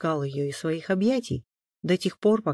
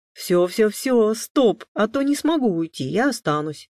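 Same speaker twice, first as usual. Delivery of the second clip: louder, higher protest; playful protest; 7 semitones higher, 7 dB louder than usual. A narrator's reading. Alexander breaking free of Maria's tender embrace, protesting. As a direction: protest while wriggling out of a hug. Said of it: "запротестовал тот, вырываясь".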